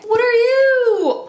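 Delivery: high, loud, drawn-out coo; excited squeal. Real, not acted. Vlogger discovering an item in the box, high delighted squeal. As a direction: squeal it, stretching the words out.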